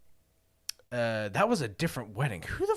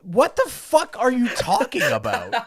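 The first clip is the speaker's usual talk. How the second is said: higher and louder, laughing through the words; laughing disbelief; 10 semitones higher, 8 dB louder than usual. Retold or imitated. Recalling how he answered the rumour, laughing outrage. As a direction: play the outrage but crack up mid-line.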